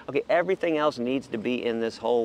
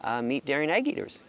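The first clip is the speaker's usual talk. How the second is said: ordinary talk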